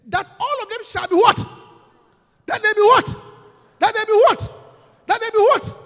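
loud, high call to the congregation; rising call, pushing them to answer; demanding the answer back from the crowd; call for response. Real, not acted. Preacher calls congregation response.

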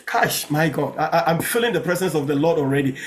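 ordinary talk, nothing marked

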